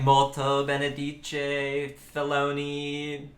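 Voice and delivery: mock Italian gibberish, slow; hammed-up fake Italian words. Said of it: "like, fake Italian".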